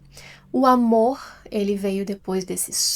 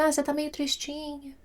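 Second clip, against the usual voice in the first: mild sympathy; 5 semitones higher, 6 dB quieter than usual. Retold or imitated. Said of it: "Falou assim".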